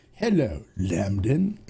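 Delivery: "Hello, Lambden" dropping low on "Lambden"; Jerry Seinfeld. Retold or imitated. Host greets a chat viewer as Jerry Seinfeld, low and sneering. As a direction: sneer the name like a TV nemesis greeting. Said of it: "it's like, uh, Jerry Seinfeld".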